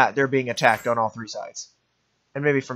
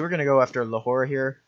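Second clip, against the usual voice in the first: ordinary delivery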